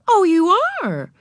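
very high, loud surprised reply; humouring librarian. A narrator's reading. Miss Evans responding to Ramona's confession, high and surprised. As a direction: react in a high, indulgent voice.

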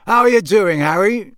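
put-on a young man's cheerful welcome; Charlie's greeting. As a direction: greet him like an old friend.

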